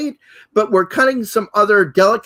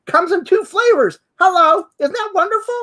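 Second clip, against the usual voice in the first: high-pitched gushing reveal; excited reveal; 9 semitones higher, 3 dB louder than usual. Real, not acted. Host showing co-host a heat-proof ruler, gushing excitedly. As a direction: make the big reveal with glee.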